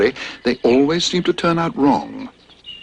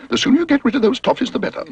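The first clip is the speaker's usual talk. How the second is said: brisk Mrs Bird; faster than usual. Acted.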